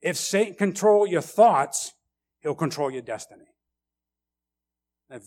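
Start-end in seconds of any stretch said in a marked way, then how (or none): 0.0-3.5 emphatic sermon warning, loud opening; preaching. Acted. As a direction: pastor hammers home a warning to the congregation, preaching emphatically. Preacher's warning, full force.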